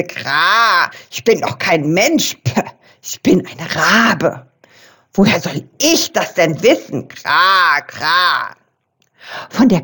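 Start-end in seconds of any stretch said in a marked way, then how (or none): 0.0-8.6 lower, croaky raven voice with caws; joyful raven, voiced lower; croaking raven. A narrator's reading. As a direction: croak it out, glad and raspy like a raven. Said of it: "krächzte er freudig".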